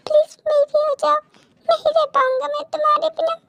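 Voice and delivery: high, tearful begging; desperate love plea. Acted. Man begs her to marry him.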